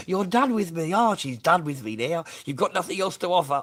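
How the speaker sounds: mocking. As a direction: taunt her words back at her. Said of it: "I just started laughing at you".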